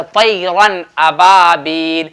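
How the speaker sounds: slow, deliberate तैरन, crisp non-nasalized N; izhar pronunciation. Shown as a demonstration. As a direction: clear N in तैरन, no nasal hum, long vowels drawn out in अबाबील. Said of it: "नून को जाहिर करके पढ़ेंगे".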